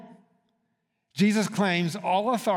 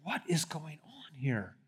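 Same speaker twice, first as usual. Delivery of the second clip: whisper; 8 dB quieter than usual. Retold or imitated. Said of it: "others like".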